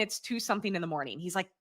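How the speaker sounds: normal talking voice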